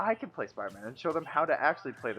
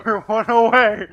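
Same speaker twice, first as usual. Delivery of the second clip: loud, drawn-out cheer; excited shout; 9 semitones higher, 15 dB louder, slower than usual. Real, not acted. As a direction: yell it out, thrilled. Excited about nearly winning.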